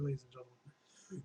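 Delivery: normal talking voice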